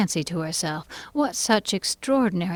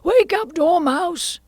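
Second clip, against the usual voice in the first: high, loud wake-up call; crying out; 13 semitones higher, 7 dB louder, slower than usual. A narrator's reading. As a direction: call out to rouse a sleeper. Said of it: "they both cried".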